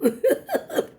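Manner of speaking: high rising giggle; a bright relieved little laugh; happy giggle. Real, not acted. Telling viewers she passed, a relieved high giggle.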